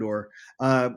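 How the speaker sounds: ordinary talk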